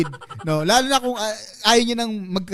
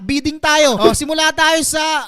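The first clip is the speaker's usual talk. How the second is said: auctioneer; 4 semitones higher than usual. Pretend. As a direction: call the bids like an auctioneer. Mock auction to chat.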